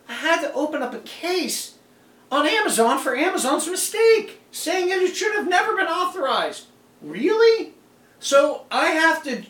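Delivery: real disbelief at having to fix Amazon's mistake; indignant. Real, not acted. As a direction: pitch climbs as the indignation builds. Indignant rant about Amazon's mistake.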